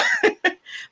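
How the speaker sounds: high-pitched burst of laughter. Real, not acted. Laughs at own joke.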